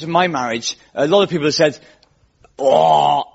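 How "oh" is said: drawn-out mock Essex "oh"; Essex accent. People reacting to the comic's marriage, Essex accent. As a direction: open with an exaggerated Essex vowel. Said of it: "many of them were from Essex".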